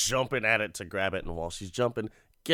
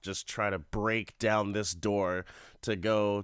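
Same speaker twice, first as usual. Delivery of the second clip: usual speaking voice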